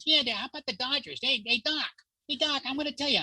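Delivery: high Brooklyn cabbie chatter; fast, chatty Brooklyn cab driver; Brooklyn accent. Retold or imitated. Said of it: "kinda like a Brooklyn accent".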